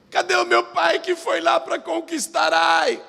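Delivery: put-on high, pleading child's voice; crying child. Children in the camp asking after their father, wailing. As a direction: cry out for a lost father.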